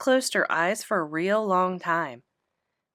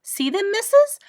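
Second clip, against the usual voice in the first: eager; 12 semitones higher, 5 dB louder than usual. Acted. Eager child showing bag.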